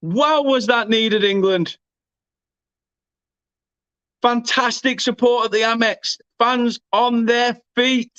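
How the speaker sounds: high, drawn-out awe; real goal celebration, pitched high and stretched; elated commentary. Real, not acted. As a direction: let the relief of the equaliser ring out. Live commentary after equaliser.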